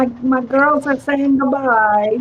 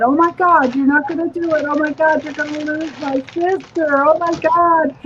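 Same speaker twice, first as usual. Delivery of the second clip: higher-pitched, fretting doll voice; voiced sassy doll; 4 semitones higher than usual. Doll protesting to its maker about her sister, mock alarm. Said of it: "Sassy just said".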